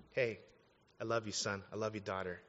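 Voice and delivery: soft, low, loving reassurance; tender father. Acted. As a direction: speak gently, like a father to his child. Voices God as tender father.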